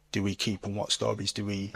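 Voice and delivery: ordinary talk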